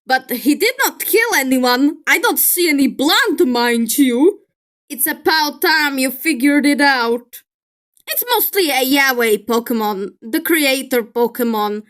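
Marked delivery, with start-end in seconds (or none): none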